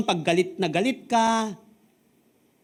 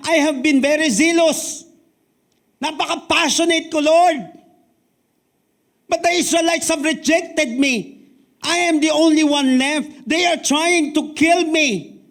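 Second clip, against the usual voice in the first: higher, louder and slow, despairing lament; despairing Elijah; 8 semitones higher, 5 dB louder than usual. Acted. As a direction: cry it out as a desperate prophet. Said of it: "So Elijah responds with despair".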